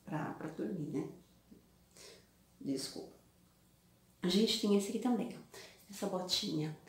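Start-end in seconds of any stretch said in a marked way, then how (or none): 0.0-3.2 drowsy and trailing off; sleepy, low, fading apology. Real, not acted. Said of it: "eu tô morrendo de sono".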